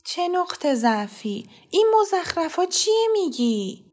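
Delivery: slow scornful reply, rising high; dismissive mother. A narrator's reading. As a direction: brush off his nonsense. (مادری تحقیرآمیز و کشیده)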